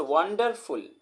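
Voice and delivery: "Wonderful" slowly split, वन-डर-फुल; slow syllable-by-syllable "wonderful"; broken into syllables. Shown as a demonstration. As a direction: give "wonderful" three separate beats. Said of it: "वन आया, डर आया, फुल आया".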